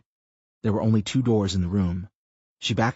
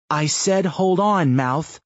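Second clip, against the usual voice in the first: firm insistence; 9 semitones higher, 5 dB louder, slower than usual. A narrator's reading. Chris holds the group back.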